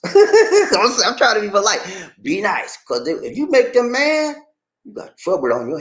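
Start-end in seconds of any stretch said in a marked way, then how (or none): 0.0-1.8 high laughing burst on the word; real laugh, higher. Real, not acted. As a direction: laugh through the start. Laughing about Detroit.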